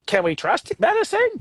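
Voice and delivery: high, slow skeptical wondering; mock doubtful question. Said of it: "for a minute, we're like".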